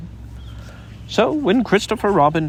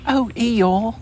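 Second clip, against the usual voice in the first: anxious cry; 7 semitones higher than usual. A narrator's reading. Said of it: "cried Christopher Robin".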